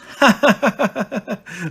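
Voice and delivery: high staccato mock laugh; hammed-up ha-ha-ha; children's laughter. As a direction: laugh like a mocking playground crowd. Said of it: "Laughed the children".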